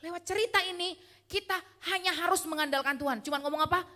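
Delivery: normal talking voice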